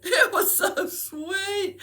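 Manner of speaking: high, slow squeal through laughter; laughing. Real, not acted. Mother remembering her sweet son, laughing. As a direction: gush it through helpless laughter.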